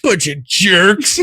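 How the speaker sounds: high, slow put-on cartoon voice; grumbling workers. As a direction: imitate a drawn-out cartoon insult. Imitates the movie workers' insult.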